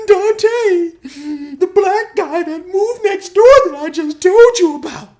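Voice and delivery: loud falsetto mock crying; [name]. [name] reminding his buddy who Dante is, hammed-up falsetto wail. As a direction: wail back at your clueless buddy. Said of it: "[name] is crying and stuff".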